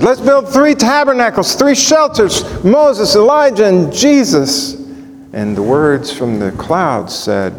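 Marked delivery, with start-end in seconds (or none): 0.0-4.7 eager Peter. Retold or imitated. Peter suggesting three tabernacles, eager and high. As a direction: push the offer out eagerly. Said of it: "what Peter suggested".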